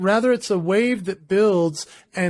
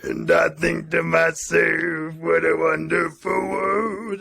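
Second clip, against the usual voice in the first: [name] style, drawn out and gritty; [name] impression; slower than usual. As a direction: sing it slow like [name]. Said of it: "How about some [name]?".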